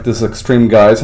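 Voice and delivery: ordinary talk, nothing marked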